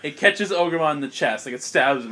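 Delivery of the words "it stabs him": usual speaking voice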